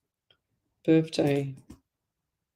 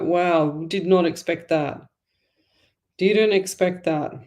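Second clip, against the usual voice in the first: usual speaking voice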